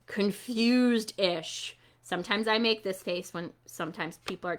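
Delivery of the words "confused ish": ordinary delivery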